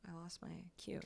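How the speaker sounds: unvoiced whisper aside. Real, not acted. Streamer losing her place, whispered. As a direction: whisper it to yourself. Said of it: "aside to chat".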